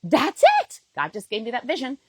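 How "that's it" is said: high, loud burst of sudden certainty; excited realization. Retold or imitated. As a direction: shout it out as the idea hits you. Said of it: "I just literally was like".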